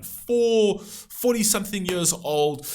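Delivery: normal talking voice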